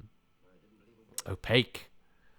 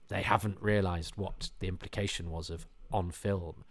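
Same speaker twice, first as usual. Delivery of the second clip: normal talking voice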